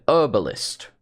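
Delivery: herbalist with a silent H, as "erbalist"; dropped H. Retold or imitated. Player mimicking the voice actress's line, H dropped. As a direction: say herbalist without sounding the H. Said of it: "British people do not say herb".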